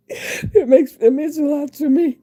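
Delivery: choked-up thanks, tears breaking through; crying. Real, not acted. Emotional thanks to viewers, crying.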